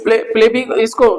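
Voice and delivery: usual speaking voice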